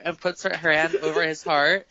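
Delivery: usual speaking voice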